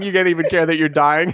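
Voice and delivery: normal talking voice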